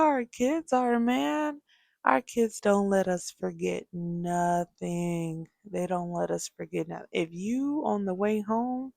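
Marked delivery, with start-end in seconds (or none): 2.1-5.5 slow, weighty statement; slow emphasis. Real, not acted. Emphatic point then quick repeat. 5.6-7.1 fast, quieter throwaway repeat; fast aside. Real, not acted. Quick repeat of the point.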